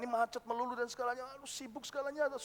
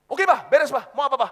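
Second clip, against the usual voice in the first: put-on eager child; 12 dB louder than usual. Child offering father anything he wants, loud and eager. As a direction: jump in loudly to please.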